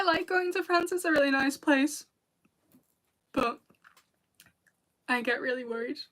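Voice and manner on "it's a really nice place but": ordinary talk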